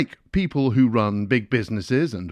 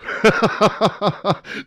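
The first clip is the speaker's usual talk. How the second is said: high, loud chuckle at his own joke; smug laugh; 9 semitones higher, 4 dB louder than usual. Real, not acted. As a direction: laugh smugly at yourself. Self-satisfied laugh at own joke.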